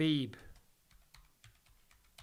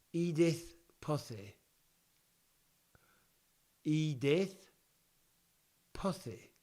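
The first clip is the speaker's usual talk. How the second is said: lisping boxer voice; slow lisp like Mike Tyson; 3 dB quieter, slower than usual. Shown as a demonstration. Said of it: "That sounds like Mike Tyson".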